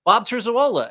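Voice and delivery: high, loud announce of the name; high triumphant reveal; knowing. Retold or imitated. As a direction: deliver the name like a punchline. Naming the winner.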